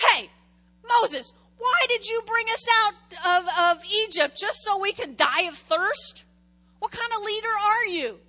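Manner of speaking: high, loud whiny mock complaint; complaining Israelites. Israelites griping at Moses, loud and whiny. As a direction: whine at Moses like an angry crowd. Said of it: "they go to Moses and they say".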